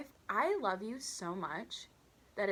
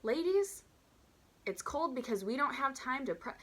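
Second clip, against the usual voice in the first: higher, explaining with sarcastic patience; exasperated lecture; 6 semitones higher than usual. Pretend. Waitress silently scolding rude customers, exasperated. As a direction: scold the ladies as if to children. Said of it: "I'm not saying this out loud".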